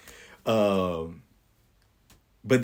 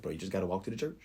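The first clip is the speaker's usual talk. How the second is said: quiet self-talk; 8 dB quieter, much faster than usual. Retold or imitated. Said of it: "I just say to myself".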